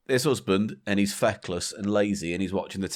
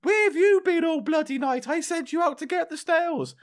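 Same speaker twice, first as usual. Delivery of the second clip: much higher shrill-free scolding wife voice, mock; fuming wife. Joke wife scolding her drunk husband, high mock fury. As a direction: play the furious wife, pitched way up.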